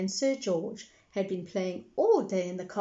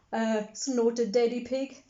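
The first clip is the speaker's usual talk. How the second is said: usual speaking voice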